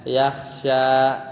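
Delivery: "yahsya" with a drawn-out final a; long final vowel. Shown as a demonstration. Teacher repeats reading without lam, long final a. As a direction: hold the end of "yahsya" long. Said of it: "Kalau lamnya dibuang bacanya".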